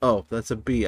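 normal talking voice

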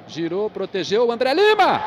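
shouted name as it goes in; explosive goal commentary. Real, not acted. Goal build-up. (narração explosiva de gol)

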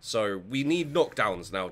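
normal talking voice

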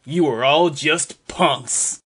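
normal talking voice

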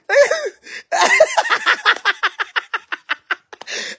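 long, high staccato cackle; laughing fit. Real, not acted. Streamer losing her suffering face to dad's teasing, laughing fit. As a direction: collapse into helpless, pulsing laughter.

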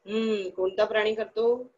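ordinary talk

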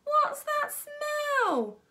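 high drawn-out disgusted yell; slow high yell across the hall. A narrator's reading. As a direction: kid yelling about the smell. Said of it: "yelled someone else".

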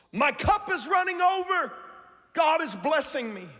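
bright, high, delighted voice of an optimist; cheerful optimist. Retold or imitated. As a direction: sound overjoyed that your cup runs over.

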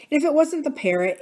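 ordinary delivery

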